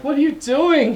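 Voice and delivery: high, startled question; a touch of mock shock; alarmed question. Alarmed retold reaction.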